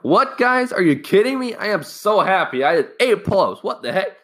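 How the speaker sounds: high, excited outburst of joy; hyped. Retold or imitated. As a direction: squeal it out, thrilled with yourself. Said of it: "I was so hyped".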